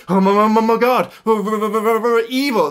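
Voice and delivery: loud, high, stammering mock horror; hammed-up shock. Rangers seeing Gia turned evil, mock-terrified.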